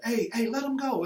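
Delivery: calling out. Retold or imitated. Another coach steps in.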